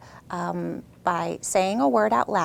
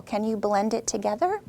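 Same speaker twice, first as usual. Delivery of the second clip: ordinary talk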